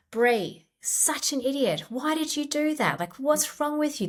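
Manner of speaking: high, scolding critic voice; harsh inner critic. Shown as a demonstration. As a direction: berate yourself like a harsh critic. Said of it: "this kind of critical tone".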